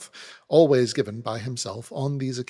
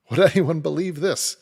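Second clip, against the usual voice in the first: louder, higher, a flustered soldier; a hint of a frustrated trooper; lightly voiced exasperated trooper; 5 semitones higher, 7 dB louder than usual. A narrator's reading. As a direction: burst out at your own clumsiness. Nervous trooper exclaims.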